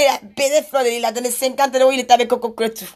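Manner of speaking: ordinary talk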